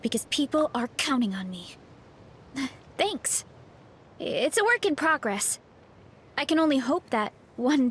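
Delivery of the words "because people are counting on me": ordinary delivery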